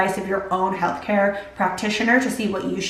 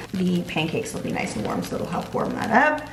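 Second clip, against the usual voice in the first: ordinary talk, nothing marked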